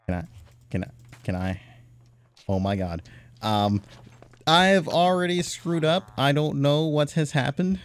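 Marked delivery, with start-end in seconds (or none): 4.5-7.8 high, loud and flustered; panicking over a mistake. Real, not acted. Gamer tells viewers he already messed up, flustered. Screwed up in game.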